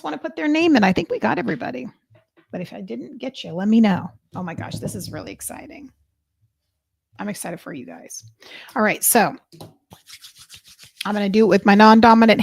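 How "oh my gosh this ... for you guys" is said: soft excited whisper. Real, not acted. Host whispering excitement to viewers before the draw. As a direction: breathe it to them without voice.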